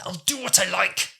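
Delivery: high, defiant quoted line; imitating defiance. Martha defying the Doctor, high defiant imitation. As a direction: throw it back defiantly. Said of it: "I just love the way she delivers that".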